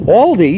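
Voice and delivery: "Aldi" said as ALL-dee; "Aldi" starting like "all"; disputed pronunciation. Shown as a demonstration. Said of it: "you're saying it wrong".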